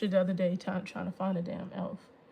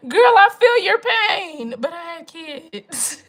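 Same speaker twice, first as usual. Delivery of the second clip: mock wail; 7 semitones higher, 13 dB louder, slower than usual. Mocking mom's complaint.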